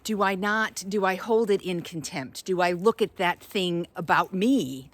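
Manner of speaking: ordinary delivery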